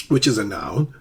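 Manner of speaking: ordinary talk, nothing marked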